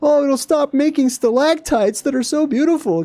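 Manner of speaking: hammed-up fussy worrier; mocking quote. Mocking officials who fear for the stalactites, high prissy voice. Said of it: "They're like".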